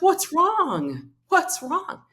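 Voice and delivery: high, alarmed "What's wrong? What's wrong?"; husband startled by her tears, asking high and urgent; worried husband. Retold or imitated. Husband alarmed as she cries.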